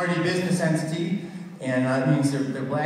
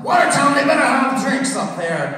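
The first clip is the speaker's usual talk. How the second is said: loud, high mock Scottish voice; a thirsty Scot, loudly; mock Scottish accent; 10 semitones higher, 7 dB louder than usual. Scottish GPS voice hoping for drinks. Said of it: "I'm thinking it's Irish".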